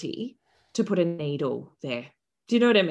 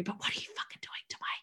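quick, angry whisper; hushed swearing; 14 dB quieter, much faster than usual. Retold or imitated. Mother challenging the doctor's dabbing, fast furious whisper. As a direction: rush it out in a whisper. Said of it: "I'm trying to hushly swear".

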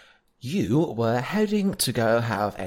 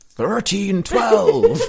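slow, higher, louder prospector yell; old prospector; 8 semitones higher, 7 dB louder, much slower than usual. Acted. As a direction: holler like a crusty old prospector. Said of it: "This is the old prospector".

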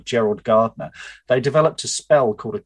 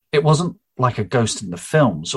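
ordinary delivery

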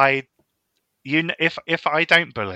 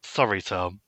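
normal talking voice

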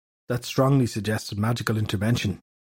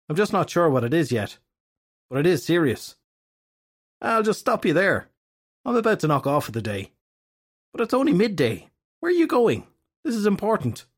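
lightly voiced, pitched-up impatient Downey; a hint of an exasperated friend; Downey's voice; 5 semitones higher than usual.

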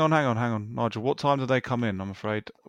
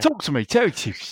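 louder Terry Tibbs catchphrase; Terry Tibbs impression; 4 semitones higher, 3 dB louder than usual. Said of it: "you did turn into Terry Tibbs".

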